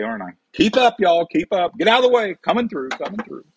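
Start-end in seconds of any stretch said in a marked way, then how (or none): 0.5-1.3 higher, bouncy call to keep up; playful call. Pretend. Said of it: "I'm just flying all over the kitchen". 1.3-3.4 coming through shout. Pretend. Rushing around the kitchen, calling out.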